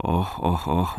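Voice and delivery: soft, puffed "oh, oh"; panting. A narrator's reading. Bungman reacts to the editor's reproach, softly panting. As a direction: pant lightly between short sighs. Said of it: "huohotti Bungman".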